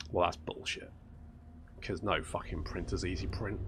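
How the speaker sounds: hushed, breathless whisper of disbelief; whispered retort. Retold or imitated. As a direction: whisper it like a scornful aside. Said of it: "and to which I'm like".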